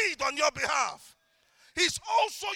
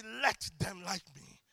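prayer; 7 semitones lower, 6 dB quieter, slower than usual. Shown as a demonstration. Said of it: "But then I say".

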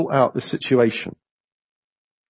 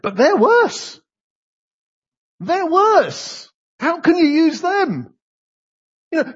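much higher, protesting that they're worse; indignant complaint; 8 semitones higher than usual. Retold or imitated. Prophet Habakkuk complaining to God, high and indignant. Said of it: "Habakkuk's complaint was".

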